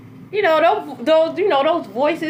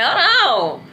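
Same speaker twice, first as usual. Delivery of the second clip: normal talking voice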